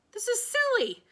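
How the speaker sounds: high exasperated shout. Acted. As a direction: shout over the noise. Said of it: "I shouted".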